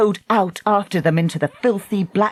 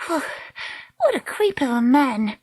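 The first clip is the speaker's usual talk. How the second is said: breathless relief, higher voice; 8 semitones higher than usual. A narrator's reading. Treacle relaxing after the escape, breathless. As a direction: puff out, then speak higher and breathless. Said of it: "Treacle said breathlessly".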